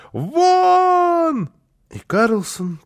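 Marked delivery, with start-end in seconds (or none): 0.1-1.5 long, high-pitched howl; wild scream. A narrator's reading. Housekeeper ordering Karlsson out, screaming. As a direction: wail the order at the top of the range. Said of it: "закричала она".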